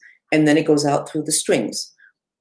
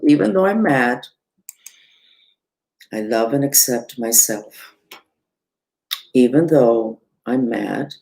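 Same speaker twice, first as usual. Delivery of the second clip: reciting a mantra; 4 semitones lower than usual. Shown as a demonstration. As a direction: chant it gently like a calming affirmation. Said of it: "I'm gonna repeat this three times out loud".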